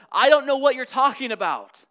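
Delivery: loud, high, forceful denial; emphatic denial. Retold or imitated. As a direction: hurl the denial at the girl. Peter's denial re-enacted emphatically.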